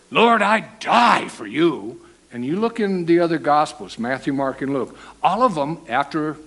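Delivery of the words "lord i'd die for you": slow, loud pledge; sworn vow. Retold or imitated. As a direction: swear it out loud, drawn out. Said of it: "He swore he'd die".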